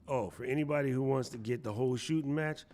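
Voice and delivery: ordinary talk, nothing marked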